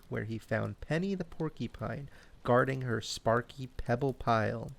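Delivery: ordinary delivery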